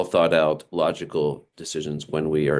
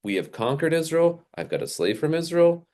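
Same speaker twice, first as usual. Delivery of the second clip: lightly voiced arrogant boast, higher; proud inner voice; 6 semitones higher than usual. Naaman thinking he is above Israel, mildly haughty. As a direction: puff up like a conqueror. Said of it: "he's thinking".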